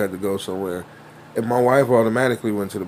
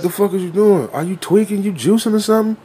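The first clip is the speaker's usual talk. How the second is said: put-on higher, incredulous kid voice; imitated street kid; 8 semitones higher than usual. Kid questioning his reckless friend, imitated incredulity. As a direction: mimic a child grilling his friend, pitch climbing. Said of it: "This is how he's talking".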